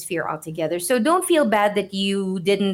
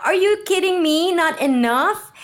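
incredulous outrage; 8 semitones higher than usual. Retold or imitated. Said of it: "And it's like".

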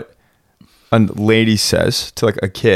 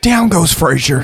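higher, slow, drawn-out fight call; mock sports commentator; 6 semitones higher, slower than usual. Joking about a dropped mic, mock fight commentary.

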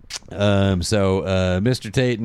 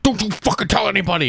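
a menacing hiss of a threat, raised high; mock threat; 12 semitones higher, faster than usual. Luke threatening Ryan after the scare.

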